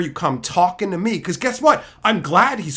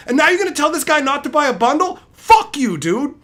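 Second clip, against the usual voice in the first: rising, louder furious tirade; angry rant; 6 semitones higher, 3 dB louder than usual. Retold or imitated. Streamer retelling berating a customer, furious high yelling. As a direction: chew him out, voice climbing high.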